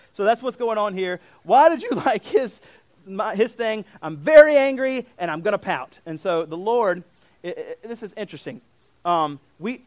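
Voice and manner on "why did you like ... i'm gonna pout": put-on loud, high sulky child with a laugh; hammed-up tantrum of a little kid; sulking child, loud and mock-angry; pouting kid.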